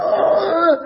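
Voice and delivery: sudden high, loud "Ah!"; mock outburst yell. Husband bugging out at home, mock yell. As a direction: imitate someone bugging out. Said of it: "the husband could bug out".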